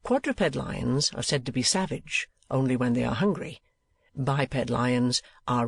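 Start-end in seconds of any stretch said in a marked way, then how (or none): none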